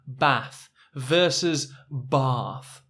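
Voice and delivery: Bath short a then Bath long ah, slow; contrast of bath vowels. Shown as a demonstration. Teacher slowly contrasts the two Bath vowels. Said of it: "That's my natural way of saying it".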